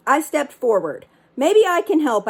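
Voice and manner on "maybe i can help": put-on child calling over the storm, louder; shouting over wind. Boy shouting over the wind to the pirates, loud. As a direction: raise it above the wind. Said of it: "I shouted over the wind".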